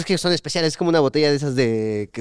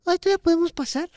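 much higher timid mom asking to get in; mom pleading. Retold or imitated. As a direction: plead sweetly like a late mother. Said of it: "y mi mamá". (mamá suplicando con voz mucho más aguda)

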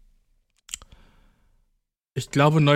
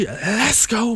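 hyped; 7 semitones higher than usual. Real, not acted. Hyped catchphrase.

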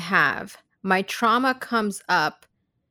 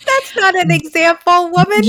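loud, high-pitched exasperated protest; teasing, raised-voice objection; playful outburst; 10 semitones higher, 8 dB louder than usual. Real, not acted. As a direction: call out in mock outrage. Mock protest at co-host's example.

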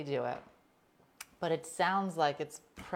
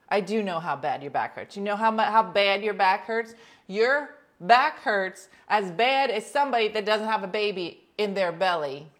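exasperated rant; 4 semitones higher, 9 dB louder than usual. Retold or imitated. Retold rant at husband.

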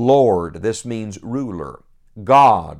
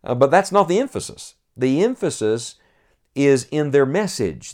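ordinary talk, nothing marked

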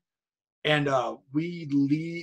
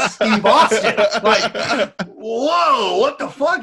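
higher, louder, laughing shock; starstruck disbelief; 5 semitones higher, 8 dB louder, much slower than usual. Retold or imitated. Hearing Steve Austin on the phone, laughing shock. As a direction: blurt it out amazed.

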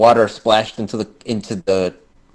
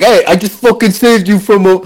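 Travolta impression; 6 semitones higher, 4 dB louder than usual. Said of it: "I'm doing my Travolta accent".

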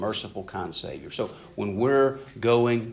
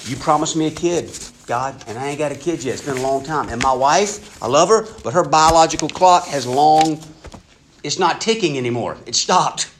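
louder, higher, exasperated complaint to God; a hammed-up weary old patriarch grumbling at God, with a laugh; Abram complaining; 5 semitones higher, 6 dB louder than usual. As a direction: vent like an old man whose promise hasn't come.

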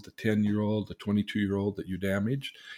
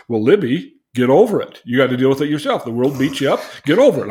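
dismissive dad; 4 semitones higher, 12 dB louder, faster than usual. Retold or imitated. Dad brushing off his daughter.

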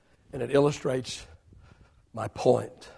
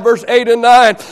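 normal talking voice

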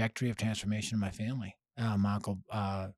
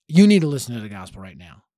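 much louder insistent demand; firm demand; 13 dB louder than usual. Retold or imitated. Teen son confronting his mom, loud and firm. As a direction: lay it on her straight. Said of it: "I go".

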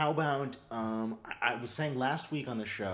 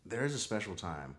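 soft dramatic show intro; quiet trailer-style announcement; announcer; 8 semitones lower, 10 dB quieter than usual. Acted. Segment intro over music.

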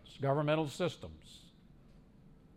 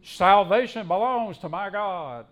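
slow, loud, raised proclamation; crying out loudly; 5 semitones higher, 9 dB louder than usual. Acted. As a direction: voice the heavenly crowd's cry from the pulpit. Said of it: "cried out in a loud voice".

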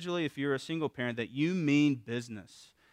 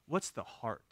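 usual speaking voice

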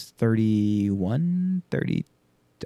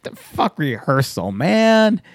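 slow, rising to a loud high man; dismissive; 5 semitones higher, 3 dB louder, much slower than usual. Retold or imitated. Telling Waz to skip rehearsal, slow dismissive. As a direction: wave the rehearsal off. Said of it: "I'm like".

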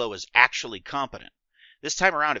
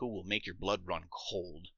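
slow, hushed and ominous; chilling slow hush; 4 semitones lower, 10 dB quieter, slower than usual. Acted. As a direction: let it chill, slow and low. Ominous villain description.

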